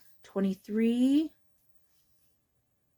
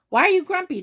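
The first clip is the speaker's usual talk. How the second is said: much higher, louder question; lightly voiced bird; 10 semitones higher, 5 dB louder, much faster than usual. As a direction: ask it like a cheery bird. Marabou asks Jim.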